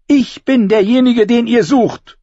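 put-on high, loud child's cry; defiant shout. A narrator's reading. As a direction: shout the claim at the armed men. Child shouts confession at armed men.

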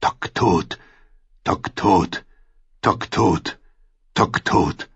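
evenly spaced, tolling pairs; dull relentless drumbeat. A narrator's reading. Drum beats on without end, dull toll. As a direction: repeat it like a funeral drum. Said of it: "dem dumpfen, unaufhörlichen Schlag der Trommel".

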